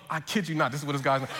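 ordinary delivery

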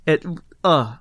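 ordinary talk

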